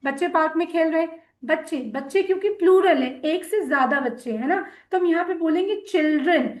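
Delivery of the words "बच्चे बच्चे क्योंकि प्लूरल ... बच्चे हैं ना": normal talking voice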